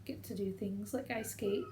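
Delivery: normal talking voice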